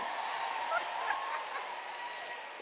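breathless squealing laugh, unvoiced; delighted shriek of laughter; high gleeful laugh. Real, not acted. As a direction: burst into a high giddy laugh. Host delighted answer is right.

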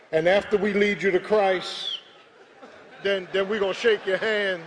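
loud, high calls to come forward; rally them up out of their seats; loud calling out. Real, not acted. Calling choir members up.

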